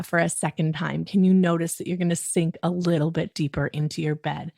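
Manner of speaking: usual speaking voice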